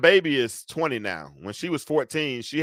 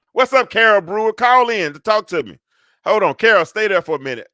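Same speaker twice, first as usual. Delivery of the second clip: excited shout-out; 7 semitones higher, 8 dB louder than usual. Real, not acted. Host calls out to a caller.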